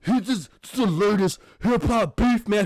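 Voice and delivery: put-on host voice, lightly hammed up; mocking hype voice. Podcaster mocking hip hop media chatter, put-on voice. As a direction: imitate someone hyping trivial gossip. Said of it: "All it's like".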